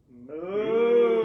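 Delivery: loud cow moo; a big loud moo. Acted. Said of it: "And so the cow said".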